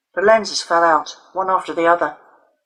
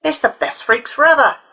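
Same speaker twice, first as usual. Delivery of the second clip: higher, louder gloating shout; crowing jeer; 8 semitones higher, 5 dB louder than usual. A narrator's reading. A boy crowing at Alison on the ground. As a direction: crow it to the gang.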